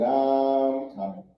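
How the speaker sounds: very slow, drawn-out I'm coming; slow stoned drawl; stoned slow reply. Retold or imitated. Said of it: "doing shit normal speed".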